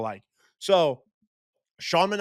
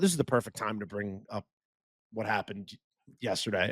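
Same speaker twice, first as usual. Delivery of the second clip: inner thought; 6 semitones lower, 6 dB quieter than usual. Retold or imitated. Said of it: "And then in my head, I go".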